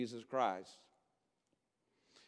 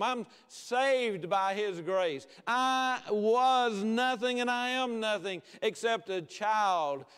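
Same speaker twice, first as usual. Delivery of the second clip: fervent preaching; 7 semitones higher, 5 dB louder than usual. Real, not acted. Preacher testifying to the congregation of being saved, fervent preaching. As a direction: lean long and hard on each claim.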